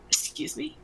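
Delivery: hushed, offended disbelief; taken aback. Acted. Reacting to a friend's remark about the new group, affronted. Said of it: "you're just like".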